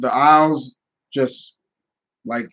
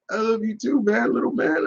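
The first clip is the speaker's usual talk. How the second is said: put-on higher, softer, tender voice for a little kid; tender to a child; 8 semitones higher, 5 dB quieter than usual. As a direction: coo gently to the boy. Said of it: "you can hear me just like".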